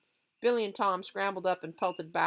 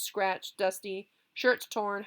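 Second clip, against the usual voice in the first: plain reading voice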